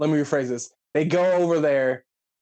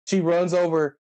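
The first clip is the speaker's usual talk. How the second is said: ordinary delivery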